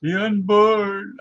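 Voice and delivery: high, slow, drawn-out mock preacher cry; drawn-out mock wail of the unborn; mocking old zealot. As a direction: ham up the pious old extremist. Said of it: "he used to say".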